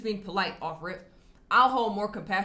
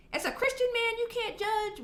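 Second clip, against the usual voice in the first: much higher, put-on mocking voice; mocking quote. The vlogger mimicking the woman on the podcast, mocking. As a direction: mimic her preachy jab in a high mock voice.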